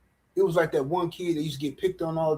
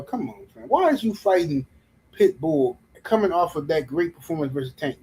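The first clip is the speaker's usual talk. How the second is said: normal talking voice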